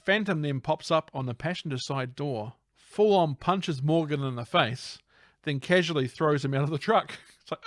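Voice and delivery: ordinary talk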